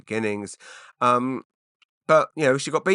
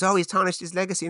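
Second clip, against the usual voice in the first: high, sneering mock of the critics; sarcastic imitation of the critics; 9 semitones higher than usual. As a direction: parrot the critics' tut-tutting in a high voice. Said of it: "Everyone goes".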